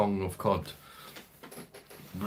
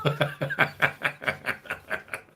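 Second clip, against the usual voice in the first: laugh; 8 semitones higher than usual. Real, not acted. Laughing at his own joke.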